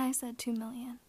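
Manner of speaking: ordinary talk, nothing marked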